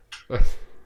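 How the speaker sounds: loud snorting laugh breaking through; can't hold the laugh in; stifled laugh burst. Real, not acted. Laughing at the chicken machine, stifled laugh bursting out. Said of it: "trying so hard not to laugh".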